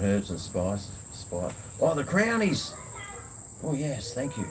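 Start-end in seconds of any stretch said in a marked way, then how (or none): 1.8-4.4 high excited exclamation; light up at the sight of a treat; delighted. Real, not acted. Spots a treat he loves.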